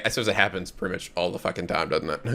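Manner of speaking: soft low mumble; quiet aside. Real, not acted. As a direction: say it under your breath.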